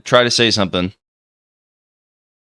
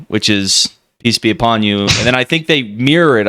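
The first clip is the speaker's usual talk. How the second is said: ordinary talk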